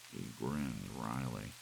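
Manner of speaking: ordinary delivery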